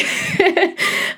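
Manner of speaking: bursts into a high laugh. Real, not acted. Laughing at own award.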